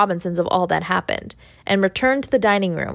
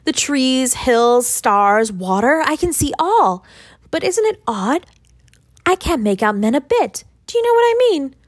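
put-on a child's voice rising high in wonder; a lightly voiced boy, high and wondering; 5 semitones higher than usual. A narrator's reading. Boy marvelling at the world.

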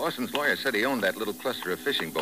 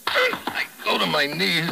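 slow, strained words as he falls; pained groan; 12 semitones higher, 5 dB louder, slower than usual. Acted. Detective struck from behind sinks groaning to his knees. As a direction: groan it out after the blow. Hit on the head, going down.

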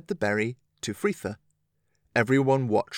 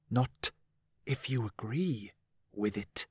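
very soft, hushed and close; low whisper; 8 dB quieter than usual. Acted. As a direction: lean in and barely whisper it. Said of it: "He spoke in a low whisper".

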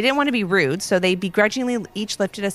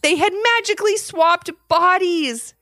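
put-on higher, louder, wide-eyed telling to kids; animated storytelling; 10 semitones higher, 4 dB louder than usual. A narrator's reading. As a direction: hook the young listeners. Narrator asks children, animated.

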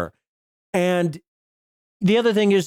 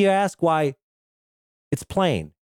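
normal talking voice

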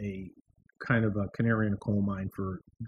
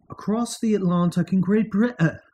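put-on higher-pitched, poor British accent; bad British accent; 7 semitones higher, 3 dB louder than usual. Host introducing Great Britain news, bad British accent. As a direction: attempt a British accent and do it badly. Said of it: "I don't do a good British accent".

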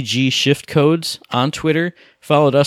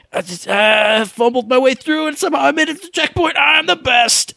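giddy triumph; 11 semitones higher than usual. Retold or imitated. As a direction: pitch climbing higher and faster into a gloat. Gleeful recap of beating a level.

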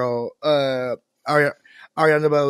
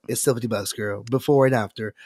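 ordinary talk, nothing marked

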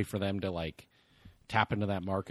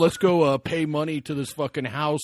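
louder hyped-up frat bro; 5 semitones higher, 8 dB louder than usual. Pretend. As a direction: yell it like a party bro. Imitating the stereotyped frat boy.

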